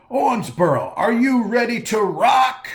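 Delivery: big arena shout, way up high; rock star yell. Shown as a demonstration. Rock star shouting at his hometown crowd, full yell. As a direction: roar it to the whole stadium. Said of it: "yell at the audience".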